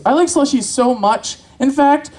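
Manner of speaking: normal talking voice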